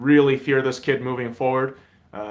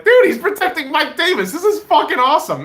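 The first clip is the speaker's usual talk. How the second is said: higher and louder, thrilled; delighted fan; 13 semitones higher, 5 dB louder than usual. Retold or imitated. Fan watching Wilson block, thrilled. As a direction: cheer at the screen. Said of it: "you're just like".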